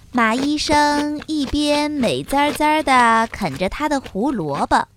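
usual speaking voice

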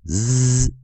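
normal talking voice

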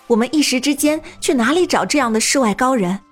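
anxious. Acted. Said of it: "清寒有些焦急地对大家说道".

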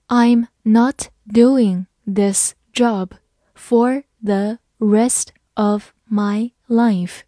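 very slow, word by word; slow read-along. Shown as a demonstration. Teacher leading learners through the sentence, slow and separated. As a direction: read it slowly, a pause after each word. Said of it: "好，慢慢来读".

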